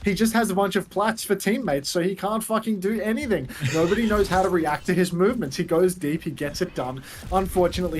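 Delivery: mock-serious esports analyst; dry caster breaking down the fight; play-by-play. Casting the match.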